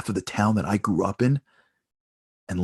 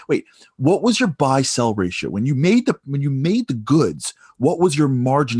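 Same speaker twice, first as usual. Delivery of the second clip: insistent questioning; 4 semitones higher, 6 dB louder than usual. Retold or imitated. Stranger keeps pressing questions.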